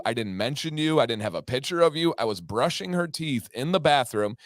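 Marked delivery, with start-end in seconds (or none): none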